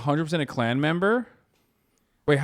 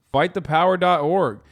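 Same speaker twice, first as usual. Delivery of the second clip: mock campaign slogan; 8 semitones higher, 8 dB louder than usual. Reading out campaign site slogans.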